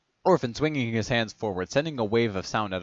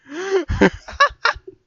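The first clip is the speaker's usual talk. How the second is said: high giggle; 10 dB louder than usual. Real, not acted. Giggling after accent.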